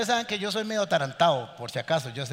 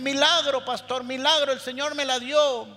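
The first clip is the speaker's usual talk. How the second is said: higher, louder mock-excited miracle report; excited churchgoer; 7 semitones higher, 4 dB louder than usual. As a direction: burst in announcing a miracle. Churchgoer announcing miracle to pastor.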